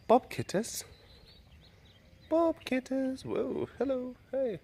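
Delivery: high, cooing voice. Real, not acted. Greeting and waving off visiting kittens, high baby-talk. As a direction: coo high at a tiny animal.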